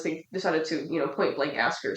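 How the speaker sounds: usual speaking voice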